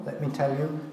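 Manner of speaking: normal talking voice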